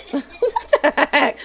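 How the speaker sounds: laughing. Real, not acted. Laughing at being teased.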